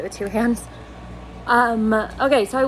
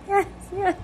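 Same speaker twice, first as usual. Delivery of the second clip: near tears; 10 semitones higher than usual. Retold or imitated. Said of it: "On the verge of crying".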